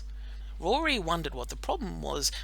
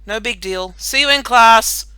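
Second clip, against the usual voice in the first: louder, higher friendly call; yell; 5 semitones higher, 13 dB louder than usual. A narrator's reading. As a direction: call it out cheerfully. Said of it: "Melanie yelled out".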